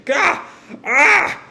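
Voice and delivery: short high-pitched yells on being hit; acted blast-hit yelps; cry of pain. Acted cries as ship explodes.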